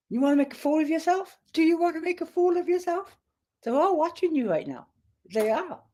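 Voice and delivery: high, coaxing voice for the dog; pet talk. Real, not acted. To her restless dog Jack, high coaxing pet talk. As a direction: sweet-talk the dog into calming down.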